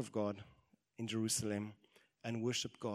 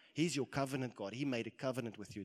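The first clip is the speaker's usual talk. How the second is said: ordinary delivery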